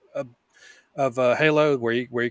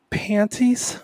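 sexy voice; 9 semitones higher than usual. Shown as a demonstration. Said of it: "as sexy as he possibly can muster".

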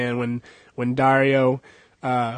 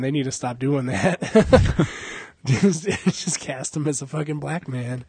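ordinary talk, nothing marked

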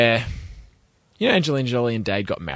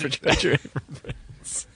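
laughing; 5 semitones higher, 4 dB quieter, slower than usual. Real, not acted. Laughing repeat of the joke.

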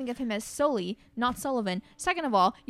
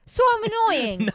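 mock offended; 11 semitones higher, 8 dB louder, slower than usual. Mock offended at being called annoying.

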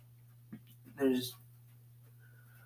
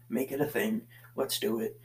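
ordinary delivery

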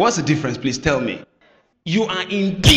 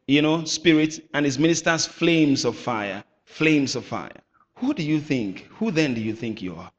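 normal talking voice

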